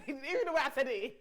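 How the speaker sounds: laughing. Real, not acted. Guest cracking up at how she said it, laughing. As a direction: crack up while talking. Cracks up at her own delivery.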